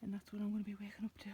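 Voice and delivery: soft awed whisper. Real, not acted. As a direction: breathe it out in a hush. Hushed repeat of the view line.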